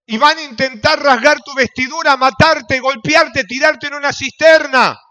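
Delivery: loud, ringing pulpit preaching; fiery sermon voice; preaching at full force. Acted. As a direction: preach it out to the whole church. Pastor preaching to congregation.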